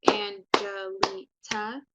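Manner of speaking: "Angelita" in slow, loud separate syllables; "Angelita" chopped into four slow, punched beats; broken into syllables. Shown as a demonstration. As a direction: clap out each syllable of "Angelita". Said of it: "Some people clap, so Angelita".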